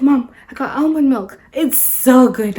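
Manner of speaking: loud, excited child imitation; excited daughter. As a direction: gush like an excited kid telling mom. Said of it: "that's how she talks".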